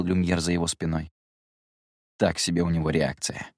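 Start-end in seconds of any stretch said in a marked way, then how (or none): 2.2-3.5 careless. Acted. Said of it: "небрежно бросил Гедеон".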